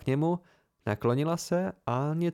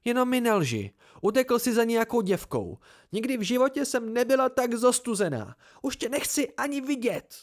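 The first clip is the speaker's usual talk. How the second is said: o dost výš, uraženě a vyčítavě; zhrzená žena; 9 semitones higher than usual. Acted. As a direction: hraj ponížená, rozhořčená žena, hlas jde nahoru. Fela hraje scénu, potlesk. (vysoký vyčítavý hlas podvedené ženy)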